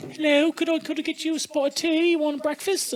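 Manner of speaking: put-on English wife offering tea in a high, polite English accent; English wife impression. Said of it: "his sweet wife from England".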